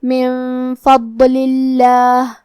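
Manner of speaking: level chant of من فضل اللہ with thin, held lillah; thin-lam recitation. Shown as a demonstration. Teacher showing a light lam in Allah, slow tajweed recitation. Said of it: "یہ حکم کو ایسے ادا کریں گے".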